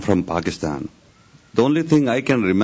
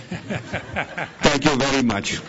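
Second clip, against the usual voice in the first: slower, higher thanks through a laugh; 5 semitones higher, 4 dB quieter, much slower than usual. Real, not acted. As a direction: say thanks while chuckling, drawing it out. Laughs after teasing his wife.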